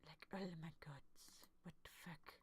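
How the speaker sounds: breathless whispered disbelief; shocked whisper. Real, not acted. Reacting to the anime scene, a stunned whisper. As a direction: whisper it like you can't believe your eyes.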